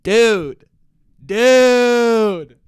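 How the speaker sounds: loud elated dude, drawn out; excited. Real, not acted. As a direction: let it burst out, can't believe it. Overjoyed she's heading to NYC.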